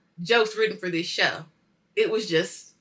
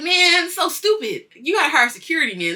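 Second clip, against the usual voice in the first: loud, high mock scolding; pitched-up imitation of a reproach; scolding cop; 9 semitones higher, 9 dB louder than usual. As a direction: chide him like an exasperated cop. Acts out cop scolding Vince.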